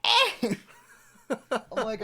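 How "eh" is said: high, loud wordless yelp; mock yelp. Reacting to friend's film pitch, loud mock yelp. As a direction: let out a short comic cry.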